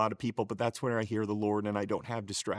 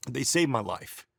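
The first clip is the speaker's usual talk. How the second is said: ordinary talk, nothing marked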